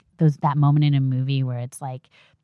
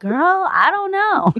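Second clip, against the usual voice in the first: put-on slow, high, playful girl-talk; sassy reply; 13 semitones higher, 5 dB louder, slower than usual. Mother answering her newborn's bewildered looks, slow and sassy. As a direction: shrug it off to the baby. Answering the baby's imagined questions.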